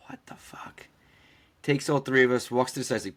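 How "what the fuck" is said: whispered disbelief under the breath; stunned whisper. Retold or imitated. Traveler recalls being told to come along, stunned whisper. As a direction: whisper it, retelling a stunned private reaction.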